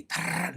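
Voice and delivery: rolled buzzing trill like a wind-up toy. Shown as a demonstration. Imitating the Energizer Bunny's buzz for viewers.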